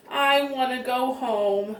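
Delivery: plain reading voice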